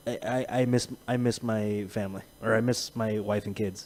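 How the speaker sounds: low, soft, halting confession; hushed and downcast; subdued retelling. Retold or imitated. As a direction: quote it quietly, low and hesitant. Quoting friend's heartfelt message.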